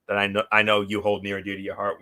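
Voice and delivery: normal talking voice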